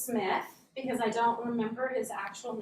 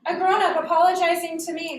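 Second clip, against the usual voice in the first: loud, higher, incredulous delight; amazed excitement; 6 semitones higher, 8 dB louder than usual. Retold or imitated. Child walks out after adult's apology, amazed and loud. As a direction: marvel at it, voice raised.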